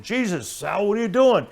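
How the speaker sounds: high, loud panicked cry; yelling at the tow driver in terror; screaming in fear. Retold or imitated. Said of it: "wagging and screaming, saying".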